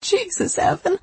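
high, cracking exclamation. Acted. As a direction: let the voice crack with shock. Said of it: "my voice cracking".

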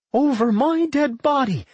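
high-pitched, indignant snort of refusal; scornful refusal. A narrator's reading. Anodyne rejecting Fiora's offer to join, scornful. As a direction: throw the offer back, pitched right up. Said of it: "Anodyne snorted".

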